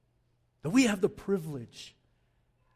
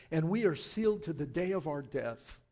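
ordinary talk

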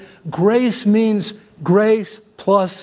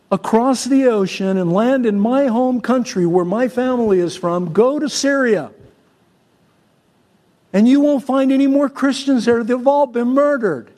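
raised, driving preacher voice; fiery preaching; 4 semitones higher than usual. Acted. Pastor urging the congregation to surrender everything, preaching hard. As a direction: pound it home to the congregation.

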